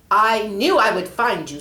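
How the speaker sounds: loud, commanding confrontation; stern angel. Acted. Angel Michael confronting the snake, loud and stern. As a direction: stand firm before the enemy.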